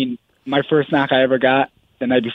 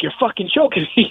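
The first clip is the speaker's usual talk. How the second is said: retold alarmed protest; 8 semitones higher than usual. Fighter imitating his wife mid-choke, protesting in high pitch. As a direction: pitch up to quote her sudden complaint.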